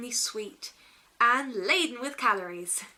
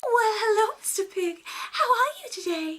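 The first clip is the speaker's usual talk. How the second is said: very high, sing-song princess greeting; 12 semitones higher, 5 dB louder than usual. Retold or imitated. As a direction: coo at the horse like a Disney princess. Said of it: "goes to the pig and says".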